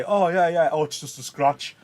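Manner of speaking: mock casual, playing the big man; joking, brushing it off. Teacher voicing Mercutio, brushing off his wound. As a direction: laugh off the wound like a tough guy. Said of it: "like, joking around, trying to be the big man".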